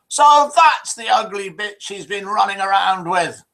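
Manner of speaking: high-pitched, mildly acted fuming; a hammed-up touch of jealous indignation. Suspicious wife looking into the glass, mock fuming. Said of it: "and then she fumed".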